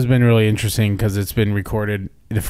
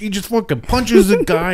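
normal talking voice

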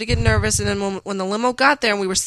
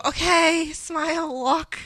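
higher, clipped steeling commands; stone-faced self-pep; 8 semitones higher, much slower than usual. Retold or imitated. Bride reenacting steeling herself before walking in, higher. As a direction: talk yourself down the aisle. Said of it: "Stone face and go".

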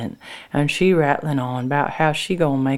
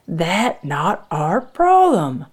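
slow, loud, higher dismissal; firm refusal; 4 semitones higher, 6 dB louder, much slower than usual. A narrator's reading. Frank refusing his wife's plea, angry. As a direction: shut the plea down, word by word.

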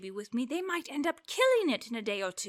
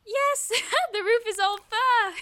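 high, louder, laughing at the sight; amused laughter; 8 semitones higher, 4 dB louder than usual. Acted. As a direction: giggle in disbelief. Laughs at furry roof.

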